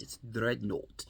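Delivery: Dreadnought said slowly, weighted; Dreadnought with oomph. Shown as a demonstration. Vlogger showing how the word must be said, with oomph. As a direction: give Dreadnought some oomph. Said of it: "you have to give it oomph".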